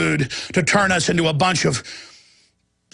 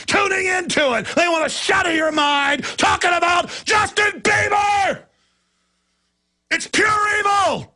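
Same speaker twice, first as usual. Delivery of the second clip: preaching at full force; 9 semitones higher than usual. Acted. Host rallying listeners against globalists, shouted preaching. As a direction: thunder it out like a fiery preacher.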